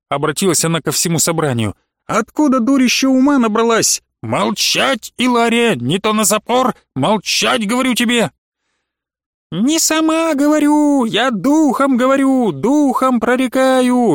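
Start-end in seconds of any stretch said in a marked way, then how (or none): 2.1-8.3 повыше и погромче, грозно; пригрози ей, чтоб замолчала; сердитый окрик. A narrator's reading. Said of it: "закричала Сандулия". 9.5-14.1 высоко и медленно, исступлённо; вещай взвизгивая, как одержимая; визгливое пророчество. A narrator's reading. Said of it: "визжала Илария".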